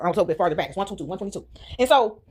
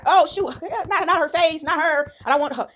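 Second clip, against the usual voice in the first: mock dismay; 6 semitones higher, slower than usual. Acting out dismayed reaction to her.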